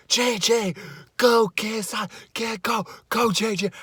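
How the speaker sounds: high, excited mock scream from the stands; retold fan scream. Fan in the stadium cheering on [name], high excited yell. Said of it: "and I screamed".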